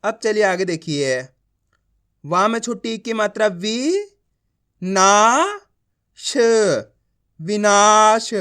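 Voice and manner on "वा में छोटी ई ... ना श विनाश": slow emphatic drill building विनाश; letter-by-letter reading. Shown as a demonstration. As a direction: stretch ना upward, then blend as विनाश. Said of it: "एक एक अक्षर का उच्चारण कर रहा हूँ".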